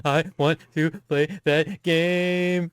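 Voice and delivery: high, slow, teasing voice; a slow, high mocking whine; mock whiny listener. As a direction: drag it out like a whiny friend. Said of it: "mocking listeners of story".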